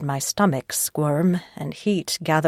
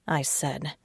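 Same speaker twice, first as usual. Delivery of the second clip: ordinary delivery, nothing marked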